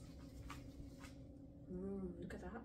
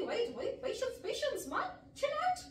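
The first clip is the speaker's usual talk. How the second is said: high, giggling wait wait; laughing self-calming; 12 semitones higher than usual. Real, not acted. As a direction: talk yourself down through giggles.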